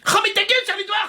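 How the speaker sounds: high-pitched comic snap; indignant retort. Acted. Scolded pupil to classmate, indignant retort. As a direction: jump up in pitch to shut them up.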